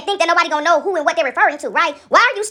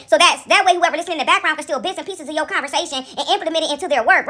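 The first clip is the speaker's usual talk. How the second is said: normal talking voice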